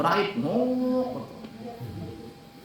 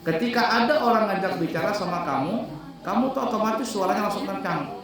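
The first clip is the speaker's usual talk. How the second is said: ordinary delivery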